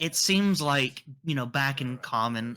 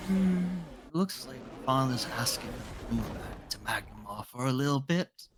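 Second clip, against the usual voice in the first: soft, frail, whispered; a hint of a frail elderly woman; frail old woman voice; 4 dB quieter than usual. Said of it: "she'll speak in a very soft, kind of frail voice".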